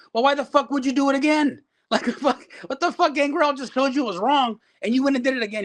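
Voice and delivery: high-pitched, incredulous retold rant; exasperated scolding. Booker to wrestler who repeated his mistake, exasperated. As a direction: act out telling someone off in disbelief.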